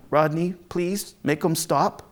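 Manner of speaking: ordinary delivery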